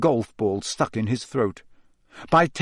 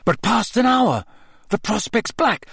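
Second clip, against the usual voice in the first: horror-struck recital; 8 semitones higher than usual. Acted. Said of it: "Harry recited".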